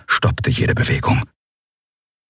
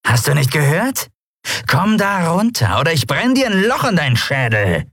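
raised-pitch, threatening pirate snarl; menacing cat-pirate; 10 semitones higher than usual. Acted. Pirate threatening the frozen boy, menacing and impatient. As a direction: threaten him, stretching the order out.

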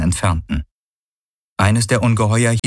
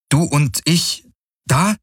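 high, slow, careful question; slow asking; 7 semitones higher, slower than usual. A narrator's reading. Kirai asks Hawks slowly, pitched high. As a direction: ask it slowly so he understands.

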